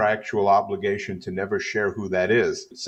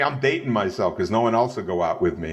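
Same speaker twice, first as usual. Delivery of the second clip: normal talking voice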